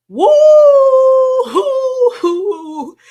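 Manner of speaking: long, high, loud "woo-hoo-hoo"; cartoon steam whistle. Streamer playing a fuming cartoon for viewers, high drawn-out hooting. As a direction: hoot like a cartoon kettle blowing steam.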